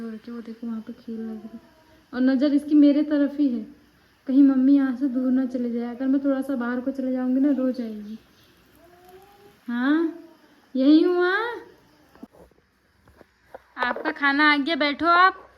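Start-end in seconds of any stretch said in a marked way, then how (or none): 2.1-8.2 much louder, speaking up; loud explaining to viewers; loud talk. Real, not acted. Speaks loudly over baby noise. 9.7-15.5 calling out. Real, not acted. Mother calls to baby.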